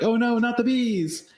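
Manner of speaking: Nick Cage impression. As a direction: stretch it into a campy scream. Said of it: "a reference to".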